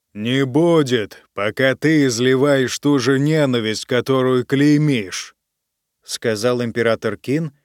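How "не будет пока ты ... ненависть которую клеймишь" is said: ordinary delivery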